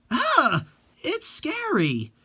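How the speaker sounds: high, slow frightened squeal at a scary photo; frightened cry. Acted. Girl recoiling from the ghost photo, frightened squeal. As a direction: shriek it out, spooked.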